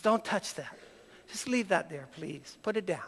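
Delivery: a hint of a protective grieving woman; hushed plea. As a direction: beg quietly to leave it.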